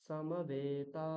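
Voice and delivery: সমবেতা with বে and তা drawn out very slowly; সমবেতা, শেষ দুটো দীর্ঘ; long vowels in সমবেতা. Shown as a demonstration. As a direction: stretch the last two syllables of সমবেতা. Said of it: "দীর্ঘ উচ্চারণ হবে".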